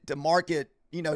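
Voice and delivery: ordinary talk, nothing marked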